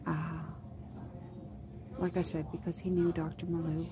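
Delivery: near-whisper, keeping it down; hushed talk. Real, not acted. Waiting for the orthopedic surgeon, hushed whispering. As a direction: murmur so the waiting room can't hear.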